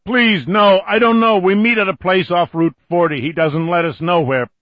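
high, loud frantic pleading; terrified begging. Acted. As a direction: beg in panic. Billy begging in terror.

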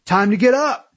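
high-pitched, forceful shout; shouting back. A narrator's reading. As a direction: shout a wake-up order across the room. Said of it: "he shouted back".

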